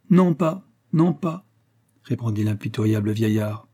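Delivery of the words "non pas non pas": curt refusal. Acted. Bailiff refuses coldly.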